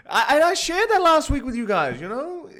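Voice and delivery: high, through laughter; laughing. Real, not acted. Telling viewers about last week's post, laughing. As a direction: talk while cracking up.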